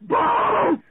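loud high panicked yell; full reenacted panic shout; reenacted scream. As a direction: belt it like you're falling off a paddleboard. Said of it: "after screaming balls like this".

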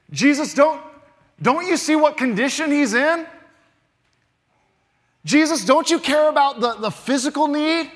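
incredulous objection, voice lifted high; acted protest. Voices listeners' objection to Jesus.

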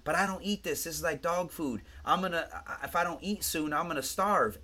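a hint of a whining lady; imitated protesting patient. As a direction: pitch it up as a hungry patient objecting. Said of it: "She goes".

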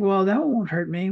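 usual speaking voice

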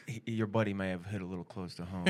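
low, subdued quoted voice; retold course manager. Course manager passing on a complaint to the comedian, low retold voice. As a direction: drop low and soft for the quote. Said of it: "he goes".

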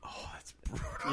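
soft, breathy, pained "that's brutal"; hushed wince of sympathy; sympathetic whisper. Real, not acted. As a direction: whisper it like it hurts. Reacting to two wrecked cars.

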